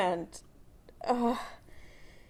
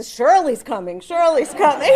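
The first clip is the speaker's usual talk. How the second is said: mock announcement; 4 semitones higher, 10 dB louder than usual. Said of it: "he would go".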